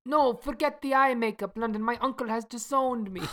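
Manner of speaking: lightly put-on Italian accent; a hint of Italian lover. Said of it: "my Italian accent".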